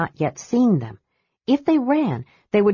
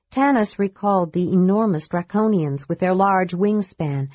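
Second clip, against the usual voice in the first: plain reading voice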